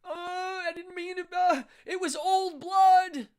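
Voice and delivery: high, weepy blubbering excuse; mock crying. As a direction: imitate the sobbing performer.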